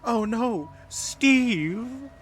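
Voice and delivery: high, loud dismayed wail; alarmed cry. Acted. Game character cries out in alarm.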